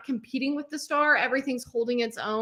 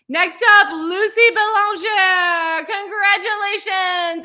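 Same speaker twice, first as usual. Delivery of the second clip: much louder and higher, name stretched slowly; excited call-out; 9 semitones higher, 8 dB louder, slower than usual. Real, not acted. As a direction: cheer the name out to the crowd. Announcing graduate name.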